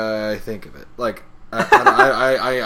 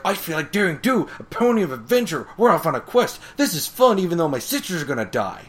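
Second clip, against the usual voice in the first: higher and louder, pumped for adventure; gung-ho Rainbow Dash; 6 semitones higher, 4 dB louder than usual. Retold or imitated. Rainbow Dash cheering the quest, high excited voice. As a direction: hype yourself up for the quest. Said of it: "Rainbow Dash is like".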